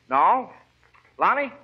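normal talking voice

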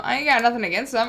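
ordinary delivery

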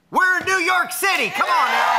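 high, very loud crowd-rousing cry; rousing shout. Real, not acted. Host hyping a New York crowd, rousing shout. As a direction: whip up the audience at full voice.

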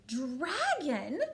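loud, excited princess yell; yelled demand. A narrator's reading. Princess spotting a flying dragon, yelling. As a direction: yell it with a rising end. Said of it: "yelled the little princess".

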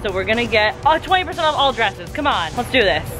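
normal talking voice

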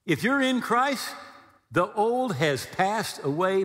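usual speaking voice

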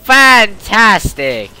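slow mocking cheer; sarcastic. Real, not acted. Sarcastic reaction, not in lobby.

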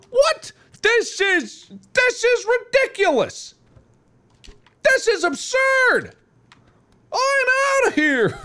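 very high, squawking indignation; mock outrage. Offended victim storming out, hammed-up indignant. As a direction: protest at the top of your pitch.

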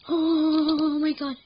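loud, drawn-out "oh my god"; shocked outburst. Real, not acted. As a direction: let a long, loud cry of disbelief burst out. Sudden shock in game.

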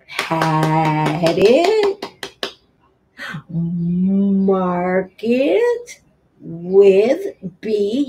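normal talking voice

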